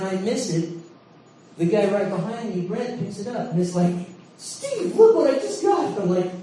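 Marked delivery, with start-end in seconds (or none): 4.4-6.0 high-pitched mock boast, louder toward the end; gloating. Brent showing Steve what he just got, gloating. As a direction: crow it in an excited high voice.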